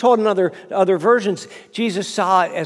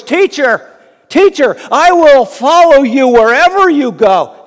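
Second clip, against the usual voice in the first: higher, loud call across the crowd; 5 semitones higher, 10 dB louder than usual. Retold or imitated. Scribe calling after Jesus, loud and eager. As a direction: call out to be heard over the crowd. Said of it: "you hear this voice".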